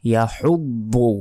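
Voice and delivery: ইয়াহুব্বু drawn out from the throat; correct demo. Shown as a demonstration. Teacher models the correct word. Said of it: "এখানে আসলে বলতে হবে".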